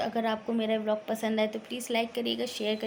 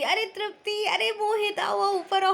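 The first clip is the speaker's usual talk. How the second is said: high, loud call up the stairs; 10 semitones higher, 4 dB louder than usual. Retold or imitated. Cousin calling the couple upstairs, a high loud call. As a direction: shout their names from above, beckoning.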